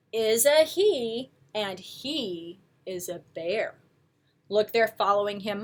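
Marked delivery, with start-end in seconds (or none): none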